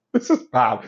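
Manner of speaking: normal talking voice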